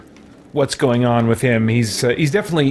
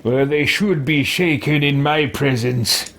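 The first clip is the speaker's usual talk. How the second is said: lightly acted haughty reply, drawn out; measured, theatrical character voice; haughty game character; slower than usual. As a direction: play a self-important character, slowly.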